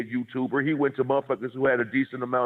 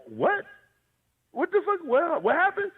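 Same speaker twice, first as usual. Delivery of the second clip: high, incredulous what-the; baffled disbelief. Retold or imitated. Retelling his reaction to the guest, high baffled disbelief. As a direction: thrown completely off guard.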